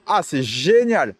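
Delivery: usual speaking voice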